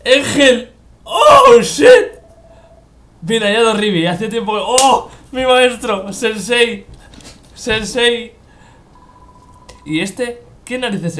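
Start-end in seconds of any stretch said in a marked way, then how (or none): 0.0-2.1 loud, high yell of alarm; startled cry, much louder and higher; shocked shout. Real, not acted. Mysterious figure appears suddenly. 4.6-8.3 loud, high delighted calls; louder, higher happy shouts; excited calling out. Real, not acted. Recognises his old master.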